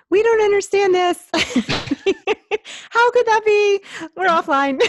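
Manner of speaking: very high, loud squeaky cartoon voice; high inner voice. Guest voicing their baffled high voice to the host, squealing. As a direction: squeal it in drawn-out mock dismay, half laughing. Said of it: "My high voice just was like".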